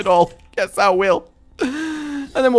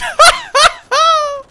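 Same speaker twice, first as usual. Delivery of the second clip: loud, high-pitched giggle; high giddy laugh; 8 dB louder than usual. Real, not acted. Player thrilled by his luck, high giggle. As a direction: burst into a squealing laugh.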